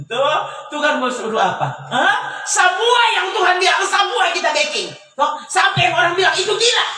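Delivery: loud and high, rousing the crowd; fiery preacher building to a shout; preaching at full force. Acted. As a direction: shout it out to the church. Preacher rouses the congregation.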